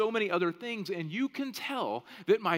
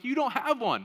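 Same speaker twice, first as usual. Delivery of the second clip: loud, higher-pitched contradiction; retold squabble, insistent denial; mock arguing; 8 semitones higher, 6 dB louder than usual. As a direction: argue back like Dad bickering with his kid. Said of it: "we argue for a second".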